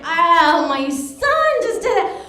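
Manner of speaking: loud, drawn-out mock horror; mortified. Mother in checkout line over son's magazine, mortified. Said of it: "I was mortified".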